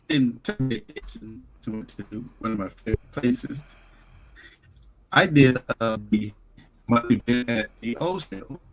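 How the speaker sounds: choppy call audio cutting in and out; breaking up on a bad connection; garbled audio. Real, not acted. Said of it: "is garbled and cutting in and out".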